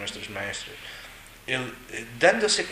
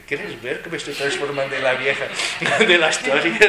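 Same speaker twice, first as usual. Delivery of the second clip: laughing; 3 semitones higher, 7 dB louder than usual. Real, not acted. Jokes turning into the old woman.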